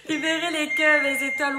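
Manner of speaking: giggling through the word Libérez; fast, airy, laughing Libérez. Real, not acted. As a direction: laugh as you start the word. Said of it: "ne me voyez pas rire".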